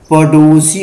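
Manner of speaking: पड़ोसी with a hard stop ḍ; stop-consonant reading. Shown as a demonstration. Teacher showing the stop-consonant reading, पड़ोसी as paḍosi. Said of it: "स्पर्श व्यंजन के उच्चारण की दृष्टि".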